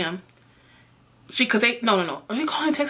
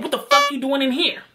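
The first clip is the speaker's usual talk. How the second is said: raised-pitch retold outburst; acting out an indignant challenge; angry confrontation; 5 semitones higher than usual. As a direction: burst out at a caught cheater. Acted confrontation from the back seat.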